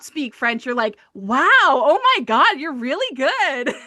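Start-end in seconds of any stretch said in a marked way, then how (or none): 1.1-3.8 gushing, swooping, louder mock awe; mock amazement. Listener who doesn't speak French praising the accent, mock amazed. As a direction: imitate someone wildly impressed, stretched out and higher.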